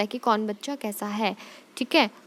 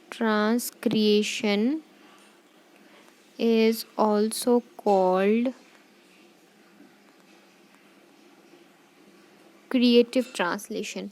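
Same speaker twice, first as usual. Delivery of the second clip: very slow, spaced words for notes; slow note dictation; much slower than usual. Shown as a demonstration. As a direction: dictate slowly with pauses.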